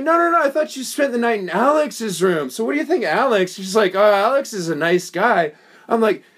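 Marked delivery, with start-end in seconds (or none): 0.0-3.5 high, publicly pointed mock query; retold loud questioning. Retelling questioning her before the whole salon, loud and pointed. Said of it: "I said it loudly". 3.9-5.5 lightly imitated woman, higher pitched; mock innocent woman. Retold woman dodging questions about Alex, mock innocence.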